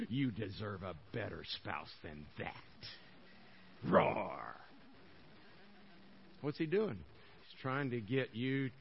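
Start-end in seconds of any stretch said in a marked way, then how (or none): 0.1-2.5 low, soft devil voice sowing doubt; tempting devil. Acted. Devil whispering to a hurt spouse, low and soft tempting. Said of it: "he roars and says". 3.8-4.5 a low mock lion roar. Preacher mimicking the devil as a toothless lion, low mock roar. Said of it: "he roars and says".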